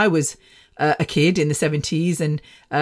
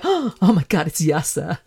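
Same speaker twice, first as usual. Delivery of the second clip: imitated swooning. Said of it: "most of the girls would be like".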